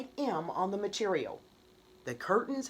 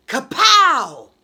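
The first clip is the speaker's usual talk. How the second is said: loud, high mock gunshot blast; gunshot sound; 8 semitones higher, 17 dB louder than usual. A narrator's reading. Narrator voicing the gunshot at the march, loud blast. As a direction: belt out a gunfire kapow.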